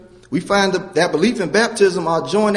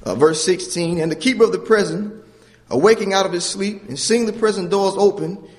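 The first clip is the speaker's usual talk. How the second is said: ordinary delivery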